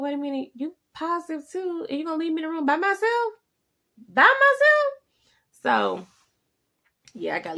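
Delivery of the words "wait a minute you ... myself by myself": higher and louder, building to an indignant shriek; you can't be serious, leaving me alone; rising outrage at a partner's nerve; outraged disbelief. Retold or imitated. Fiance leaves her alone, outraged.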